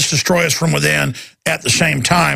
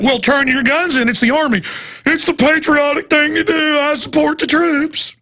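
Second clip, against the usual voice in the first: high sarcastic sing-song; mocking patriot voice; 10 semitones higher than usual. Host mocking gun-owning patriots, sarcastic high voice. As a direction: parrot the flag-waver with scorn.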